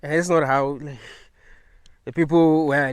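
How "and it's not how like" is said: ordinary delivery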